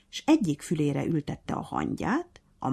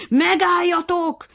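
commanding shout; 9 dB louder, much slower than usual. A narrator's reading. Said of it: "vezényelt a nyúl".

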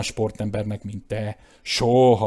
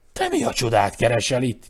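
louder, demanding exclamation; shouted; 6 dB louder than usual. A narrator's reading. Claude confronting his brother at the bed, loud. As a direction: call out in indignant surprise. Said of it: "kiáltotta".

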